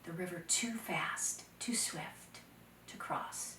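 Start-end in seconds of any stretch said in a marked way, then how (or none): none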